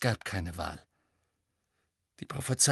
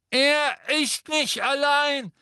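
high, loud and slow defiant call; 14 semitones higher, 6 dB louder, slower than usual. A narrator's reading. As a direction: shout it down from above, much higher. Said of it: "rief eine Stimme von oben her". (laut und langsam von oben gerufen, viel höher)

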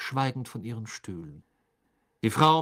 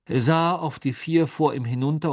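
ordinary delivery

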